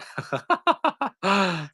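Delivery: a high burst of laughter at herself. Real, not acted. Laughing at her own coffee-stand meltdown, high laugh.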